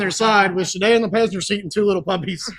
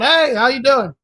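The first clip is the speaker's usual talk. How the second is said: goofy greeting; 5 semitones higher than usual. Retold or imitated. Imitates JoJo goofing with camera.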